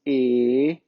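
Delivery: E drawn out low, gliding up; vowel E demonstrated. Shown as a demonstration. Teacher listing Portuguese vowels, E slowly sounded out. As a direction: sound out E slowly, rising at the end. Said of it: "nós temos E".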